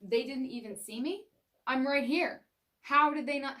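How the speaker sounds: rising fury at not being seen; a hammed-up offended driver; mock indignation. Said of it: "it's almost like".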